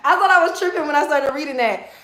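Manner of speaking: excited disbelief. Real, not acted. Excited disbelief at chat message.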